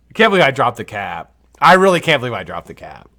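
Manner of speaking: loud embarrassed outburst; exasperated exclaim. Real, not acted. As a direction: blurt out the disbelief. Repeats line, then mutters it.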